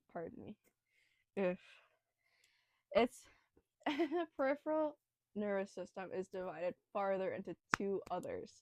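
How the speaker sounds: ordinary talk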